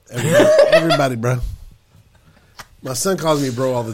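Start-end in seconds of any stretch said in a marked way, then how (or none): 0.1-1.4 laughing through the words. Retold or imitated. Laughing mimicry of the quote.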